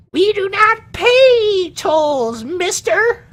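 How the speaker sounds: very high, slow and loud squeaky refusal; defiant halfling. Acted. Halflings refusing the orc toll, high squeaky defiance. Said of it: "And they go".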